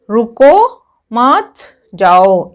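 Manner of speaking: slow, loud रुको मत, pause before जाओ; comma pause demonstration. Shown as a demonstration. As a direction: stretch रुको मत, break, then drop on जाओ. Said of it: "इनके बीच में अगर हम कॉमा लगाते हैं".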